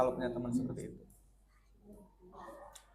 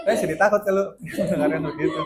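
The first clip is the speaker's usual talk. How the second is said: loud, higher-pitched, laughing protest; amused laughing; 6 semitones higher, 11 dB louder than usual. Real, not acted. Teacher reacting to students' exaggerated listening stares, amused laughing. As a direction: say it through real laughter, raised voice.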